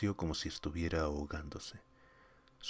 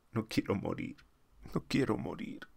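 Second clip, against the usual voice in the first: frightened pleading; 8 semitones higher than usual. Acted. Astronaut panicking, adrift in space.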